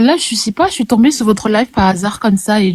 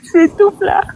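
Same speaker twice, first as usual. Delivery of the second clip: en riant; 11 semitones higher, slower than usual. Real, not acted. L'invitée rit en parlant.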